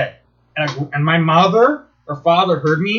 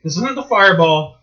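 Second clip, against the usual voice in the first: ordinary talk, nothing marked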